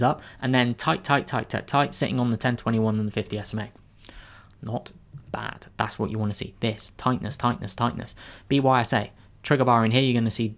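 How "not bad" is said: breathy whisper, almost to himself; whispered aside. Real, not acted. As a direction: whisper it approvingly under your breath. Quiet aside while reviewing chart.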